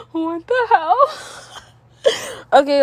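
laughing off her own tears; real tearful giggle; teary laughter. Real, not acted. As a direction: giggle through crying. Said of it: "so I can stop crying".

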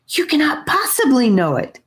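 hoarse panicked hiss, strained high; a frantic whisper-shout in the dark; scream whisper. A narrator's reading. Annika, panicked, insists in a strained scream whisper. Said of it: "Annika scream whispered again".

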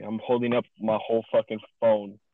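fed-up loud complaint; loud rant. Real, not acted. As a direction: blurt it out fed up. Ranting about holding phone.

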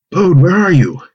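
a high call of "Bode" across the farm. Acted. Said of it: "Dad called out".

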